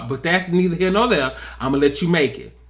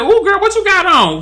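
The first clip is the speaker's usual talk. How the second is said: much higher mock-shocked squeal; shocked "ooh girl"; 12 semitones higher, 3 dB louder than usual. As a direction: gasp it up high like a scandalized cousin. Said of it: "Alora came up and said".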